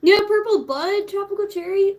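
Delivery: talking while chewing; words round a bite of food; mouth full. Real, not acted. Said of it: "Sorry, I shouldn't talk with my mouth full".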